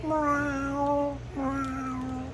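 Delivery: high, soft imitated cat meow. Shown as a demonstration. Comedian demonstrates his cat trick, high cat meow. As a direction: mew like a little cat, very high. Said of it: "So you go from".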